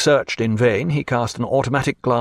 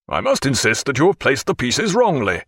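strong voice; 2 semitones higher than usual. A narrator's reading. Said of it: "in a strong voice".

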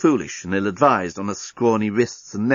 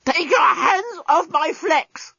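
very high, slow strangled squawk; choking robot; slower than usual. A narrator's reading. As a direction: squeal through a throttled robot throat. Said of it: "choked the despot".

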